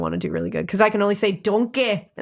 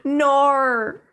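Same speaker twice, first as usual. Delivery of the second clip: put-on Australian accent; 6 semitones higher than usual. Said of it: "her Australian accent in the one word".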